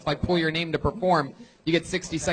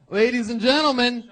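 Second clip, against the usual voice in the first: announcer build-up; 4 semitones higher, 5 dB louder than usual. Real, not acted. Host introducing the drummer to the crowd, announcer hype. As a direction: build to a big name reveal.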